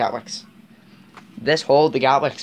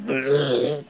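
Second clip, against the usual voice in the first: rough, sputtering mock engine groan; imitated engine noise. Mocking the three thousands' engine, an imitated sputtering groan. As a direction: imitate a spluttering old engine in disgust. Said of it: "They're just like".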